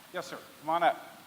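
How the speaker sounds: ordinary talk, nothing marked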